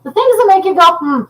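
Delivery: theatrical hmm of suspicion; mock pondering. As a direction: ham up a puzzled catchphrase. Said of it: "And I was like".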